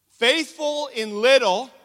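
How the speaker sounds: slow, high, very loud declaration; loud proclamation. Real, not acted. Preacher proclaiming the key point to write down, loud and slow. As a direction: belt out each word slowly.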